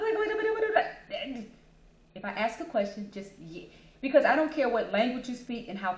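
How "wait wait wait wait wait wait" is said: much higher, laughing plea to stop; laughing wait wait. Retold or imitated. To a chatty caller, laughing and begging them to stop. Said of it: "I'm like".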